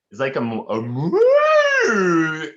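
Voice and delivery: high, loud whale call attempt; orca voice. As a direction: try a whale sound. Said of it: "do a whale call".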